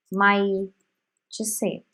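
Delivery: ordinary talk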